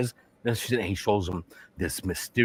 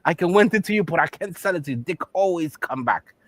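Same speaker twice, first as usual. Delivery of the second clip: put-on raised-pitch caricatured Chinese accent; mock Chinese accent; 8 semitones higher than usual. Shopkeeper greets a customer, mock Chinese accent. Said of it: "The Chinese man".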